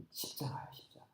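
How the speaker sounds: soft, dropping to a whisper; hushed reverence. Real, not acted. Preacher revealing what Christ's power is, hushed. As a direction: let the answer fall to a whisper. Hushed answer after shouting.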